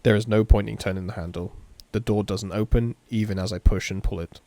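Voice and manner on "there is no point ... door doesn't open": usual speaking voice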